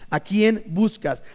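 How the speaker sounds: slow, searching ask; drawn-out probing question; slow emphatic question. Shown as a demonstration. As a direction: let it land slowly. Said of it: "brincar del texto".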